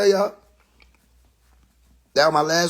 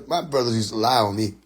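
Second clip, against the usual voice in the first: usual speaking voice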